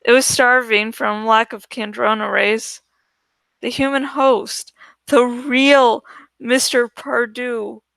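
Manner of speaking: ordinary delivery, nothing marked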